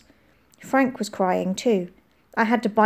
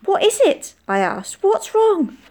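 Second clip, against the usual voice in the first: high, loud worried question; alarmed question; 12 semitones higher, 5 dB louder than usual. A narrator's reading. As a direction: ask in sudden alarm. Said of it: "Her eyes were huge and panicked".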